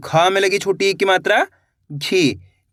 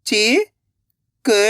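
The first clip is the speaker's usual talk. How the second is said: very slow, stretched syllables, rising at the end; sounding out syllables; 2 semitones higher, much slower than usual. Shown as a demonstration. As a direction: sound it out slowly, letter by letter. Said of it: "एक एक अक्षर का उच्चारण करके".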